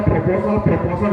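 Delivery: ordinary delivery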